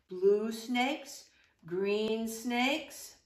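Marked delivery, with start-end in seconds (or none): none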